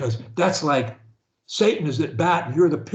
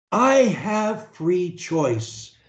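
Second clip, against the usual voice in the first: very slow, each word spaced out; slow dictation; much slower than usual. Shown as a demonstration. Coach leading client in first-person repetition, slow. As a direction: give it slowly so they can repeat. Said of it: "first person singular".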